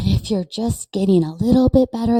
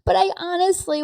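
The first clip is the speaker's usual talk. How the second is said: laughing through real tears, much higher; choked-up laugh, fighting tears; teary-eyed; 12 semitones higher than usual. Real, not acted. Moved by imagining her proud older self, laughing through tears. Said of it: "Why am I teary-eyed?".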